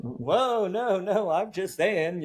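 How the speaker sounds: high, mock-alarmed backing off; a critic backing down once handed the leash; backpedalling critic. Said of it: "And then I get like".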